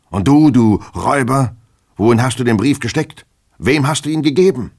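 loud, high-pitched accusing shouts; furious interrogation. Acted. Master grilling his servant about the lost letter, furious.

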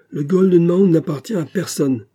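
indignant outcry; protesting. A narrator's reading. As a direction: object hotly. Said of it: "protesta Hunter".

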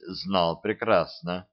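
plain reading voice

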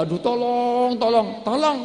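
high, strained calls for help; desperate cry for help. Acted. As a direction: cry out like an accident victim. Acted accident victim crying out.

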